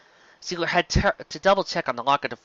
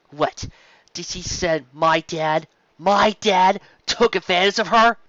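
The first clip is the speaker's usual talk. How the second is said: indignant; 6 semitones higher, 6 dB louder, slower than usual. A narrator's reading. Sparkle's rising indignation.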